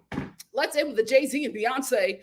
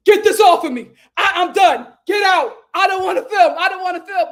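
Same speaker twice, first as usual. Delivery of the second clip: loud, raised mock yelling; retold yell; 7 semitones higher, 10 dB louder than usual. Amber yelling at the crew, loud retold shout. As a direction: belt it like she's storming off set.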